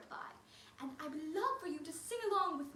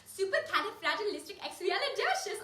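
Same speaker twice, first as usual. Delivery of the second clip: rattled off faster, a little louder; quicker take for the kids; 3 semitones higher, 5 dB louder than usual. Shown as a demonstration. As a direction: race through the long word. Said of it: "Little bit faster".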